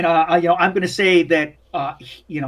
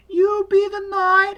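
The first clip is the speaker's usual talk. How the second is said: put-on high, slow child voice; high-pitched drawn-out boy voice; little boy; slower than usual. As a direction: hand out roles like a kid at play. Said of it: "And he's like".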